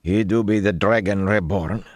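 unconvinced character voice; doubtful. A narrator's reading. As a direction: repeat it with open doubt. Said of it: "the fellow said doubtfully".

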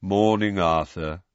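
mournful, tomb-like greeting; sepulchral. A narrator's reading. Mournful wizard greeting Arthur in the lift, sepulchral. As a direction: greet him in a sepulchral drone. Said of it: "he said in a sepulchral voice".

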